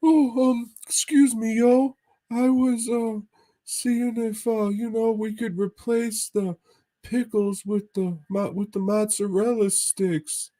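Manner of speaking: squeaky goofball ordering food; Mahoney impression. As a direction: ham up your buddy ordering, way up high. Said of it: "And fucking Mahoney's like".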